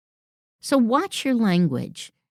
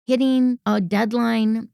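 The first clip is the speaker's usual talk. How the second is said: ordinary delivery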